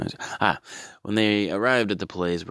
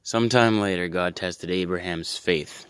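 ordinary talk

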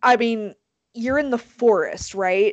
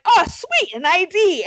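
high-pitched eager cheer; hammed-up naive excitement; mock delight; 9 semitones higher, 4 dB louder than usual. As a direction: play cops thrilled by an easy lead. Retold cops delighted at finding ID.

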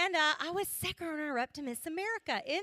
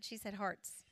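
hushed, soft mock aside; a quiet aside to the lady beside her; amused aside; 6 semitones lower, 9 dB quieter than usual. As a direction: lean in and whisper the joke. Mock whispered aside to neighbour.

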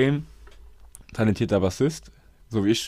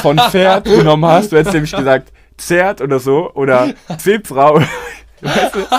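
laughing; 6 semitones higher, 11 dB louder than usual. Real, not acted. Laughing at the guess.

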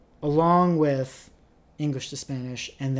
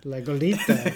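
laughing repeat. Real, not acted. Repeating friend's Spanish word.

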